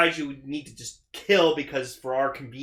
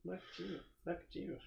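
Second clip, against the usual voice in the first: Nakajima whispered softer; quiet repeat; 4 semitones higher, 19 dB quieter, faster than usual. Shown as a demonstration. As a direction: repeat Nakajima under your breath. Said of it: "I said Nakajima".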